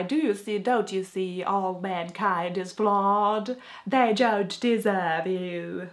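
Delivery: slow, drawn-out posh villain voice; mock a wordy lord in a slow, poshy accent; Ares mock impression. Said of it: "this very weak voice".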